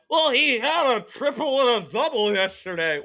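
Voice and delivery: high, loud know-it-all voice of a chat commenter; mock chat viewer. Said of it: "put in the chat".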